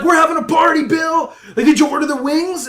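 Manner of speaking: high, loud hammed-up party shout; an imitated host yelling over a party. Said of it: "And you're like".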